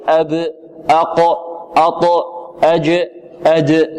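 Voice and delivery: each letter ends in a short echoing bounce; proper qalqala bounce. Shown as a demonstration. Teacher drilling the echo on each stopped letter. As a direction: bounce the stop out of the closure. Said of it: "تجعل فيه اضطراب في المخرج".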